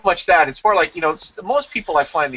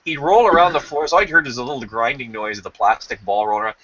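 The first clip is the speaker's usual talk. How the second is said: usual speaking voice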